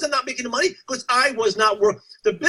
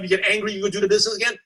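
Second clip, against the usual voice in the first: ordinary delivery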